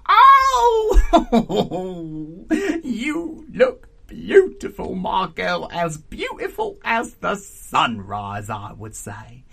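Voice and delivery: loud, high opening with a filthy laugh; raucous old auntie. A narrator's reading. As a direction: crow over the girl as a raucous aunt. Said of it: "a raucous voice cut fast".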